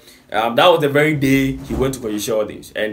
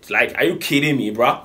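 ordinary talk, nothing marked

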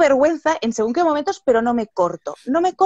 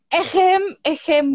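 awkward "ahem"; 6 semitones higher, slower than usual. Retold or imitated. Guide learning her rude mistake, mock embarrassed throat-clearing. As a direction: spell out an embarrassed "ejem, ejem".

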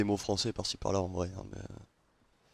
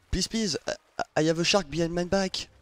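higher, put-on woman's voice, pleading; 6 semitones higher, 4 dB louder than usual. Begging the other ship to listen, in a mock woman's voice. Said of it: "avec une voix de meuf".

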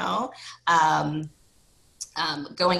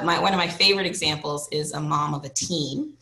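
ordinary talk, nothing marked

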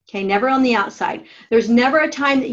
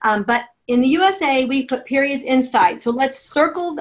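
ordinary talk, nothing marked

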